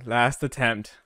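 normal talking voice

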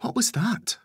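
put-on very high child's voice; high-pitched, startled little question. A narrator's reading. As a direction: ask like a child just woken by a bang. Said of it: "asks Charlotte".

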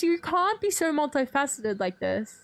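ordinary talk

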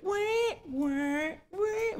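high sung siren wails; Kill Bill siren wail. Retold or imitated. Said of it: "the Kill Bill thing".

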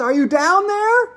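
high, drawn-out calling; shouted. Acted. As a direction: holler slowly down a hole to your daughter. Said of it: "shouted Missus Salt".

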